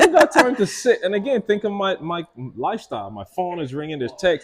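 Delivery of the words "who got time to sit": much higher, laughing scoff; scoffing at the idea; mocking dismissal. Dismissing journaling, laughing scoff. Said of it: "I'm like".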